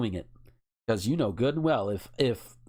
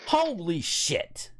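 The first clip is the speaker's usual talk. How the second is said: shocked; 5 semitones higher, much slower than usual. Real, not acted. Reacting to the figure.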